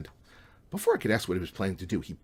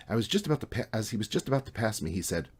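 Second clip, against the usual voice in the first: ordinary delivery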